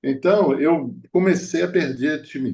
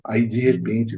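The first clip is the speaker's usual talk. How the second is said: usual speaking voice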